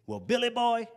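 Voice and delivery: put-on high raspy old man. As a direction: rasp it like an old mentor. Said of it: "in his raspy voice".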